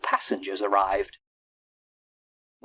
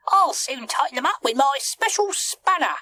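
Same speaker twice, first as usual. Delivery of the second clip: high-pitched, busy little character; high cartoon monkey voice; monkey first mate. A narrator's reading. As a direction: puff it out in a high voice. Mike the monkey.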